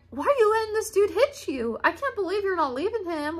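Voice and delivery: high, exasperated mock of mom; mock mom scolding. Daughter mimicking her mom's scolding, high and exasperated. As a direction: pitch it up like a scolding mother. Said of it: "and you're like".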